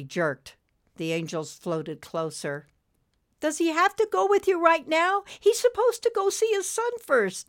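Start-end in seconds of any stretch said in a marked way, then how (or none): 3.4-7.5 very high, insistent plea; pleading. A narrator's reading. Friend begs angels to spare the man, pleading. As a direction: beg in a high, pitched-up voice. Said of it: "Nimbus pleaded".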